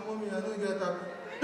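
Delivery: put-on soft, faint sick child; weak child. Sick child answering mama, soft and weak. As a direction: whimper it softly.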